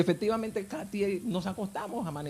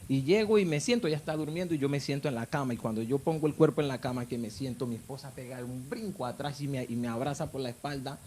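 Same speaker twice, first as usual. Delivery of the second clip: ordinary talk, nothing marked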